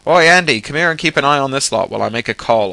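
high, loud call across the mall. Acted. Guard hailing Andy from the escalator top, loud call. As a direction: shout through cupped hands to a far colleague. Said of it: "cupped his hands to his mouth".

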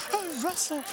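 high squeaky alarm at a friend; panicked yelp. Retold or imitated. Panicking rider calling out to Russell at the turn, high squeak. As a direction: cry out his name mid-panic. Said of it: "And I'm like".

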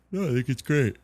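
words stretched by a yawn. Real, not acted. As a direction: talk through a sleepy yawn. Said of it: "Sorry, I'm yawning 'cause it's late".

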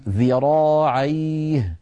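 slow, chanted "ذراعيه" with voiced th; clear dhal. Shown as a demonstration. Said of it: "فلا بد من بيان صوتها".

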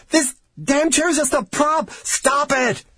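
high, frantic yelling; enraged shout. A narrator's reading. Enraged researcher shaking the dying man, shouting. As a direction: shout to make him stop, losing control. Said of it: "he shouted".

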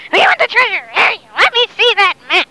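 high, insistent pirate clamour; demanding pirate mob. Acted. Pirates demand the map from their leader, high and insistent.

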